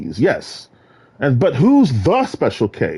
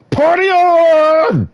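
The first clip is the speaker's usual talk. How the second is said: high, loud, stretched "Party on"; party shout; 13 semitones higher, 5 dB louder, much slower than usual. Acted. Celebrating his freedom, a long loud party cheer.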